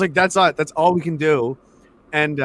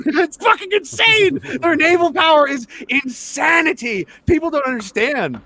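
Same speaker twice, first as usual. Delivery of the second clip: high-pitched, incredulous excitement; amazed emphasis; 11 semitones higher than usual. Real, not acted. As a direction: marvel at overwhelming power, voice way up. Amazed at US naval power.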